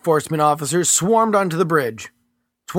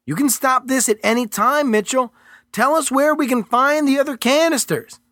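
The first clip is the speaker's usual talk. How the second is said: higher, firm and condescending; agent's character voice; 11 semitones higher than usual. Acted. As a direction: talk down to him coolly. Agent Merritt pressing Mitchell.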